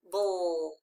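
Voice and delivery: "Bull" drawn out low, falling; long "Bull" sinking in pitch; isolated second syllable. Shown as a demonstration. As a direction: stretch "Bull" as one lone syllable. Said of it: "Read the second syllable".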